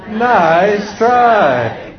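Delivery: high, drawn-out singsong "nice try"; cheerfully unfooled parent, stretched out; big-grin comeback. Shown as a demonstration. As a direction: sing it through a big grin. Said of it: "What's the answer?".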